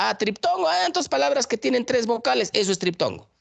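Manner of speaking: high, eager imitation of a confused learner; imitating mistaken students. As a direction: lightly act out a pupil who thinks they've got it. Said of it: "meten a la cabeza y dicen".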